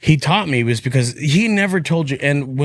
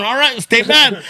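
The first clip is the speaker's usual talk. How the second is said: Joey's pumped-up voice; 9 semitones higher than usual. Retold or imitated. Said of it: "pump himself up".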